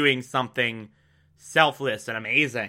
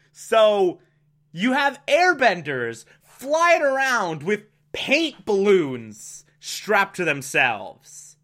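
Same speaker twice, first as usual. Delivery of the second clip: ordinary delivery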